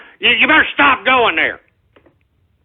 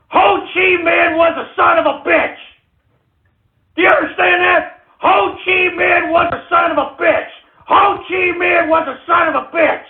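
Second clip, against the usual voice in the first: higher, hammering shout; angry shouting; 5 semitones higher than usual. Real, not acted. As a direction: drive it home at the listeners. Ranting at trolls on show.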